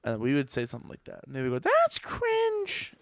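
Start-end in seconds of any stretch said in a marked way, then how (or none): 1.6-2.9 put-on high, snooty teen voice; mocking teen voice. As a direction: sneer it like a bratty teen. Same mock voice repeated.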